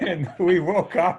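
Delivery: said through a laugh; amused laughing punchline. Real, not acted. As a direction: laugh as you say it. Guest laughs at own joke.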